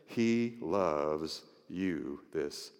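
very soft, low, near whisper; hushed, tender reassurance. Acted. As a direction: tell one hurting person softly, slowing at the end. Intimate earnest assurance to each listener.

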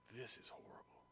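hushed, whispered realisation; acted whisper of dismay; horrified whisper. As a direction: whisper it in dawning horror.

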